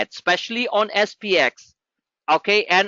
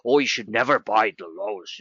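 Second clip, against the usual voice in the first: lower, dismissive parody of a smug guru; mocking rival gurus; 10 semitones lower than usual. Trader parodies what other services teach, low and mocking. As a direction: mimic their advice with scorn. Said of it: "They say".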